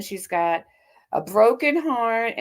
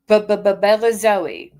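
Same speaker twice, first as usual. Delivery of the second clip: mocking stutter; 7 dB louder than usual. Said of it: "that's how she's gonna say it".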